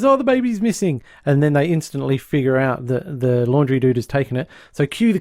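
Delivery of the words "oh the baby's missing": panicked Mo. Retold or imitated. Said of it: "Mo bursts in and he goes".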